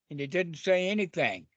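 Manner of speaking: ordinary talk, nothing marked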